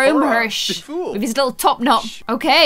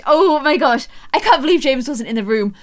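ordinary talk, nothing marked

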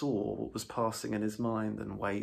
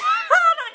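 toad laugh; 15 dB louder than usual. A narrator's reading. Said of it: "Toad laughs".

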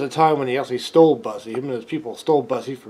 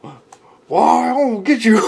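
mock threat; 9 semitones higher, 9 dB louder than usual. Performer threatens.

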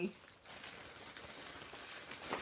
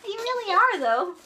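squeaky laughing helium voice; 10 semitones higher than usual. Real, not acted. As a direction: marvel at the chipmunk squeak. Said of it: "My voice is so weird".

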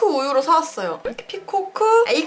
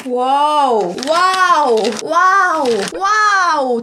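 excited; 5 dB louder, much slower than usual. Real, not acted. Excited over brownie chip smell.

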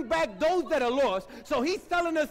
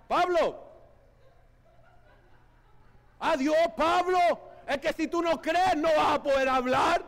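lively Dominican Spanish, calling out; 3 semitones higher than usual. Shown as a demonstration. Dominican Spanish for the congregation, ending in a shout. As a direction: Dominican accent with a closing shout. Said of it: "In your best Dominican".

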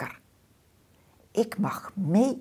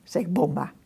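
usual speaking voice

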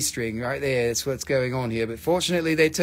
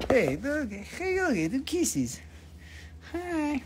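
high, softer pet talk; high-pitched doggy greeting; cooing to a dog; 9 semitones higher, 6 dB quieter, slower than usual. Real, not acted. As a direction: coo sweetly to your dog.

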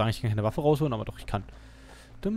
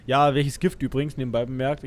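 ordinary talk

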